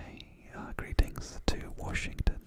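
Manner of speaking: usual speaking voice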